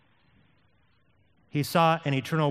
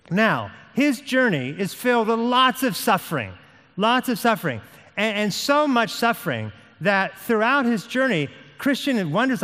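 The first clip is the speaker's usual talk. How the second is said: raised, urgent pulpit delivery; impassioned preaching; 6 semitones higher, 3 dB louder than usual. Real, not acted. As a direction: press the story home, pitched high.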